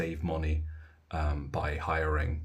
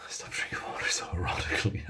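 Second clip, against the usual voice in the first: breathy whispered jab at a viewer; a mock-scandalised whisper; teasing whisper. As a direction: hush it out as a cheeky joke. Teasing whisper about a viewer comment.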